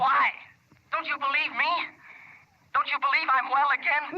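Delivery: high-pitched, wounded outburst; frantic, insistent questioning; agitated demand. Acted. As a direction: press her, voice climbing high. Angrily challenges her disbelief.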